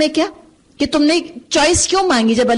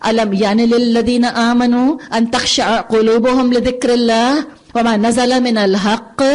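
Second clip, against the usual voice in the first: chanted Arabic verse in recitation voice; Quran recitation. Acted. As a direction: intone the verse as tajweed recitation.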